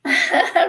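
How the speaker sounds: burst of laughter. Real, not acted. Viewer comment amuses her.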